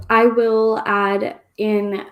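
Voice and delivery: normal talking voice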